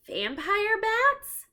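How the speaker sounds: high, slow, drawn-out alarmed question; shocked. Acted. Jack reads about blood-sucking bats, drawn-out high alarm. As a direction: gasp it out in disbelief.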